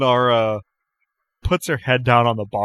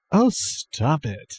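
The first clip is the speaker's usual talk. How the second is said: drawn-out, high "oh, stop it"; bashful, flirty protest, stretched out; higher-pitched, slow playful brush-off; coy bartender; 5 semitones higher, 4 dB quieter, much slower than usual. Acted. GM voices coy, flirty Jan.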